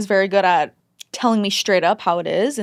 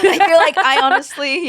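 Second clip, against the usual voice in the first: high, loud laugh through the words; 8 semitones higher, 6 dB louder than usual. Real, not acted. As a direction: laugh it out, higher and louder.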